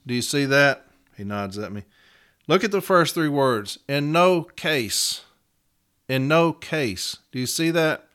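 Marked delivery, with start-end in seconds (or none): 3.9-7.2 NO stretched out slowly in each phrase; emphatic repetition. Retold or imitated. Advocate pointing the arbitrator to three words, emphatic. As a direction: say "In no case" twice, slowly, leaning on no. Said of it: "That's emphasis".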